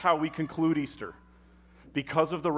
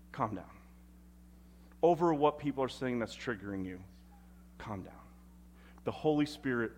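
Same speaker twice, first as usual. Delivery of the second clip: soft, low, settling voice; calming; 5 semitones lower, 5 dB quieter than usual. Real, not acted. Preacher telling a congregation to calm down, soft and calming. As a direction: soothe them, easing down quieter with long pauses.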